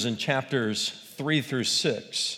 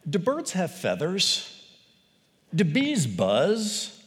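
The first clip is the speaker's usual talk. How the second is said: drawn-out, higher sarcastic question, "Do bees buzz" very slow; mock obvious question; 6 semitones higher, slower than usual. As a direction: ask it as if the answer is painfully obvious. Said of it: "It would be like us saying".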